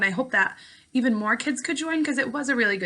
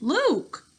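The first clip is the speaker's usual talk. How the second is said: insistent call; 8 semitones higher than usual. Acted. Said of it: "her voice got more insistent".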